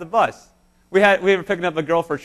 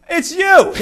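high, loud cry of recognition; sudden realization, amazed; surprised recognition; 12 semitones higher, 8 dB louder than usual. Retold or imitated. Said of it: "and they go".

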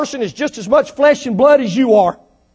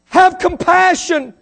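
slow, higher pleading plea for compassion; pleading rich man; 6 semitones higher, slower than usual. Retold or imitated. Preacher voicing the rich man begging for compassion. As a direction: beg slowly for mercy, voice raised. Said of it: "He says".

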